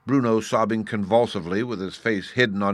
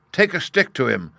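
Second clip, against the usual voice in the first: loud, higher angry order; shouting; 6 semitones higher, 5 dB louder than usual. A narrator's reading. Vice Warden ordering beggar beaten, shout. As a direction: shout for a beating. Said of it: "shouted the Vice Warden".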